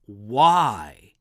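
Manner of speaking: "Why?" said alone, low and pressed out with weight; preacher singles out the question word, stressed. Shown as a demonstration. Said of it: "We should also notice the question".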